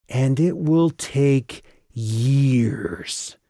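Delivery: slow, drawn-out words. Acted. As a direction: stretch each word out, very slow. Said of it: "Calliope drew out the word".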